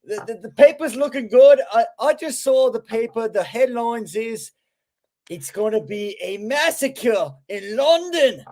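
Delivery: British impression. KJ hypes the Mets game to the hosts, mock British accent. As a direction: hype the London series in a mock British accent. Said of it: "that was a good British impression".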